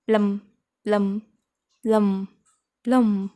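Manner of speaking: प्लम said loud and short, ending on the m; plum, no b. Shown as a demonstration. Teacher drilling plum before plumb, loud and clear. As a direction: repeat प्लम with a clean m. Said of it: "अब यहाँ पे बी का हमको pronunciation करना है".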